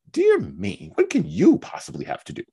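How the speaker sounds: high, breathy sneering question; Mary's scoffing disbelief. Acted. Mary scoffs at Anne's claim to be busy, high and sneering. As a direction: scoff at her sister's excuse.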